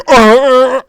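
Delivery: high, loud imitated glitch groan; loud mock groan. Game master imitating Discord choking on the audio, loud mock groan. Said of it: "Because Discord's like".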